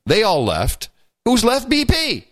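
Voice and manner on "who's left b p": rhetorical punchline. Real, not acted.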